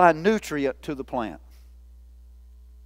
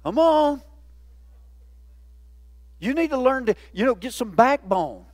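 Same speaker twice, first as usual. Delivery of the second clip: normal talking voice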